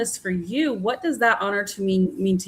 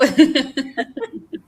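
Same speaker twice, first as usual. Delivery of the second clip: loud laugh; 6 semitones higher, 5 dB louder than usual. Real, not acted. Host laughs after the quote.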